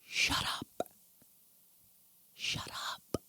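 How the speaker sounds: mom's hushed whisper, voiceless; whispered shut up. Retold or imitated. Mom quoted to her daughter, whispering shut up. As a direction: hiss it out under the breath. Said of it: "my mom's like".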